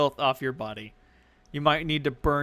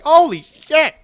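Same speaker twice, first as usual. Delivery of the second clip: high, loud hobgoblin exclamation; shocked shout; 10 semitones higher, 11 dB louder than usual. Acted. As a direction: holler down in startled haste. Hobgoblin reacts from window.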